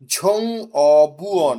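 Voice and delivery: "ঝং অবুয়ন" very slow and loud, every syllable stretched; pronunciation demo. Shown as a demonstration. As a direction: model "ঝং অবুয়ন" with u-o, not ui. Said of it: "এটা তো উ অ".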